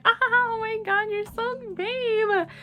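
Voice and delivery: high, drawn-out squeal of delight; hammed-up fawning girlfriend; mock gushing. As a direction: gush sweetly in a put-on high voice, dragging the last word. Mistress gushing over promised bag.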